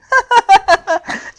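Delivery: squeaky high cackle; a high gleeful giggle; high cartoon laugh. Fu's laugh in the story.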